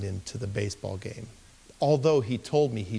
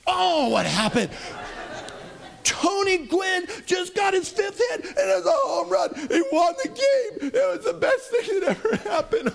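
emotional. Retold or imitated. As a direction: shout it out, overjoyed and choked up. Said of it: "I'm emotional".

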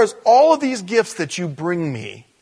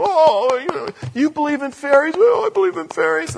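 high-pitched mock childlike pleading; silly high voice, hammed-up; mock fairy believer; 13 semitones higher, 3 dB louder than usual. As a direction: squeal it, poking fun at naive believers. Mock Peter Pan fairy believers.

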